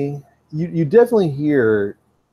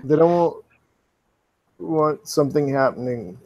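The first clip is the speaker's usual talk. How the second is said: Trey; 2 semitones higher than usual. Acted. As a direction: haltingly warn them, pausing between phrases. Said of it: "you hear Trey's voice again".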